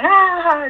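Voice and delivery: high, loud mock squeal of kids playing; imitated playful squeal. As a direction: mimic children shrieking in play. Imitating children at play.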